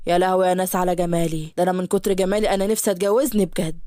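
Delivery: low, self-admiring mock awe; a man in love with his reflection; vain Yusuf. Yusuf admires himself in mirror.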